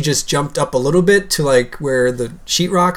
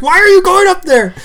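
mock scolding; 4 dB louder than usual. Owner wrestling the cat after its climb, high mock scolding. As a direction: playfully re-enact fussing at the cat.

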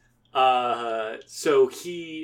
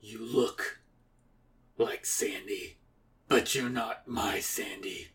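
low, hushed, halting rejection; grave disbelief; 4 semitones lower, 7 dB quieter, slower than usual. Acted. Akira facing a false Sandy, low hushed gravity. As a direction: say it softly and gravely, with pauses.

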